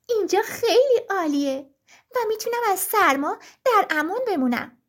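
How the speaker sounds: high, small pleading bird voice; a tiny cold bird begging for help; little lark voice. Acted. The little lark begs the birch tree for help, high and pleading.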